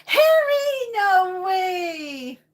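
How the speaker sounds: high, loud squeal of surprise at a friend; excited greeting cry. Real, not acted. Greeting Harry on her live stream, a high excited cry. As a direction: shriek his name with delight.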